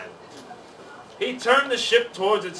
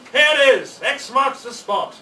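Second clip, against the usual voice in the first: loud, high triumphant pirate call; 7 semitones higher, 7 dB louder than usual. A narrator's reading. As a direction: call out the treasure find in triumph. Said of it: "Pete finally called".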